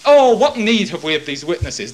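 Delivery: loud, sarcastic dismissal; mock the priest's convenience; mock dismissive. Preacher mocking the high priest's excuse, loud and scornful.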